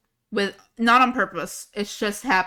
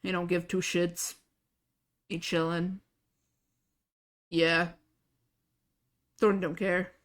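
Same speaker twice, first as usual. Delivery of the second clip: emotionless character voice, slightly low and quiet; Thorn; 3 semitones lower, 7 dB quieter than usual. Shown as a demonstration. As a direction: drain all emotion, softly and indifferently. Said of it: "I just gotta lose all emotion".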